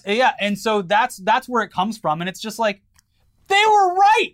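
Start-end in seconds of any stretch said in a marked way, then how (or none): 3.5-4.3 loud, high burst of vindication; emphatic exclamation. Real, not acted. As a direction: exclaim it in exasperated conviction. Exclaims that France was right.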